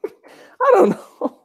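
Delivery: high, slow wheezing laugh through words; can barely get it out for laughing; cracking up at the call. Real, not acted. Laughing helplessly.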